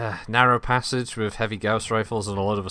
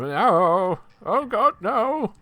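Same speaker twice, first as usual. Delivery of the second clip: rising panicked wail, high; 11 semitones higher, 4 dB louder, much slower than usual. Retold or imitated. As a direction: squeal it out in mock panic. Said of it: "I've got this, he been".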